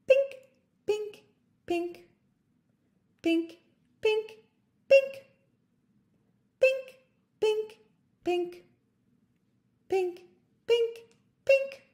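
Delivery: pink squeaked high, hopping up and down; squeaky bouncing pink. Shown as a demonstration. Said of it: "say it in a bit of a different way".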